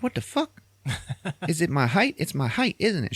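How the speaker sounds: high-pitched mock indignation; indignant questioning. Marcus turned down over his height, indignant. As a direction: squeal the accusation, wounded. Acted rejected date, indignant.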